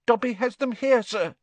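high pleading elf voice; anxious high little elf; house-elf voice. Acted. Dobby the house-elf speaks.

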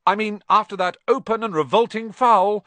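high, loud sarcastic commentator; cheeky announcer rephrasing; excited commentator. Acted. Commentator correcting himself.

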